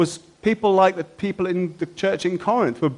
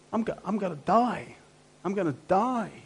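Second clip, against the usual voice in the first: softer, higher voice of mild despair; hushed despair; 4 semitones higher, 8 dB quieter than usual. Acted. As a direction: voice a quiet, acted dread of dying. Voicing Paul's feelings of despair.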